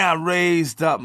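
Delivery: ordinary talk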